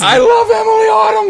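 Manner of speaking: high, loud fan yell; excited shout. Real, not acted. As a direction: yell your love, thrilled. Fan greets guest.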